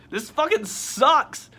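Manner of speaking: high, loud, drawn-out complaint; exasperated outburst. Real, not acted. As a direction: vent loudly, leaning on the last word. Vents about the game's ending.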